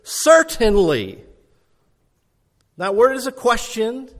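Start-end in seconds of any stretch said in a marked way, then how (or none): 0.0-1.2 loud, slow, emphatic assertion; higher, forceful declaration; insistent. Acted. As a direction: insist on it, raised and drawn out. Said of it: "what he kept insisting was this".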